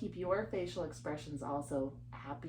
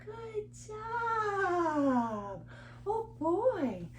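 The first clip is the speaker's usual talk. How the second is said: long high sing-song cheer; excited praise; 12 semitones higher, much slower than usual. Acted. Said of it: "keep praising her".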